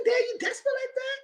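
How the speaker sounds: very high, squeaky disbelieving cry; shocked disbelief. Retold or imitated. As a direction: squeal it in mock horror. Retelling what she told friend.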